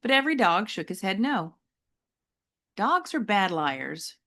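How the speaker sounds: ordinary delivery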